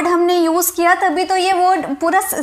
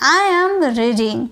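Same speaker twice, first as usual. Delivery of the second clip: "I am reading" drawn out slowly, word by word; slow model sentence; much slower than usual. Shown as a demonstration. Teacher models the new sentence for the class, slowly. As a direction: say "I am reading" slowly for students to repeat. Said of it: "क्या बोलेंगे?".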